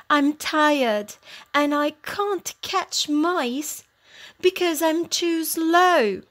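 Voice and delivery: a hint of a weary cat's lament; sad old cat. A narrator's reading. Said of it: "She's very sad".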